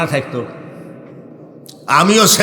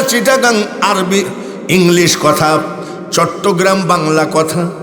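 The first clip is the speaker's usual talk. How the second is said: normal talking voice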